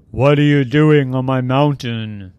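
booming god's challenge; big loud voice. Acted. Zeus confronting intruder on his mountain, booming. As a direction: boom it out loudly. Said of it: "loud voice said".

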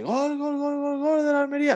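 high radio goal shout; stretched Spanish football goal cry; goal call. Retold or imitated. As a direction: belt it like a radio commentator calling a goal.

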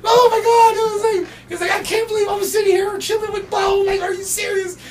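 screaming high with disbelief; starstruck fanboy. Retold or imitated. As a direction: shriek like a fan meeting his idol. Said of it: "He can't contain himself".

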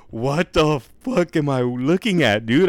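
high, loud, stretched-out astonishment; retold disbelief. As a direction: drag the words out in mock shock. Retells his shock at the gorilla.

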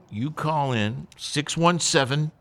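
ordinary talk, nothing marked

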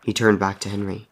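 usual speaking voice

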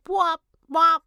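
high, loud bwop calls; crow call imitation. Host imitating the crow's call, high and loud bwop. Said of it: "he was like".

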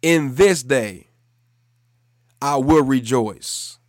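loud, slow, emphatic declaration; said like meaning it. Shown as a demonstration. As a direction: declare each phrase with full conviction for repeating. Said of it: "say it as you mean it".

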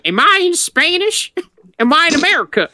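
high-pitched comic questioning; imitated remembered quote. Retelling a line heard in high school, high comic imitation. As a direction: push the questions up high, mock bewildered. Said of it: "I heard that once".

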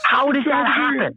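high, drawn-out disbelief; incredulous. Real, not acted. Storyteller to friends about his only street fight, incredulous. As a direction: ask it slowly and high, in disbelief.

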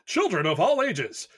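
booming circus ringmaster announcement. Acted. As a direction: announce it like a big-top ringmaster. Circus-style podcast intro.

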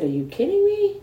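very high squeal of amazement; amazed disbelief. Retold or imitated. Seeing the happy congregation, high amazed squeal. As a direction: squeak it out in pure astonishment.

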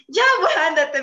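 loud, high imitation of mother; mock-annoyed mom calling the bluff; exasperated mom. Mami daring her little daughter to go, loud mock exasperation. Mimics mom's answer to child.

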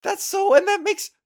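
high, excited aha; light up with understanding; realization. Real, not acted.

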